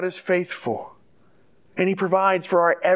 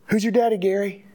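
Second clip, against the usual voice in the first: a coach's raised, higher challenge to his player; 3 semitones higher, faster than usual. Retold or imitated. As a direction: ask "Who's your daddy" like a coach demanding an answer. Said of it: "try to say it in the tone in which it's said in the movie".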